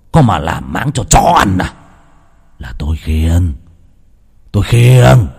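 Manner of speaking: put-on low slurred taunting drunk; a drunk son mocking his father. A narrator's reading. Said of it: "không làm chủ được chính mình".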